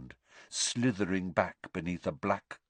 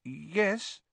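uncertainly; 9 semitones higher than usual. A narrator's reading. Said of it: "said Neville uncertainly".

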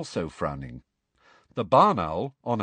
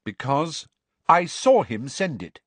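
a touch louder, indignant Cho; angry; 6 semitones higher, 5 dB louder than usual. Acted. Cho defending Harry against the caretaker, mildly angry. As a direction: stand up for him firmly. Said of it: "said Cho angrily".

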